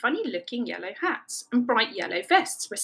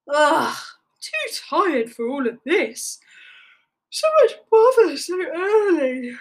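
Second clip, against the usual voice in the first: tired sloth; 6 semitones higher, 4 dB louder than usual. A narrator's reading.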